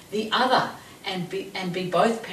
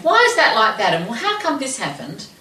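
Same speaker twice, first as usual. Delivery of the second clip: louder, higher fault-finding questions; critical retort; 4 semitones higher, 8 dB louder than usual. Retold or imitated. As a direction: fire them off like a nagging critic. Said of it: "I felt very critical".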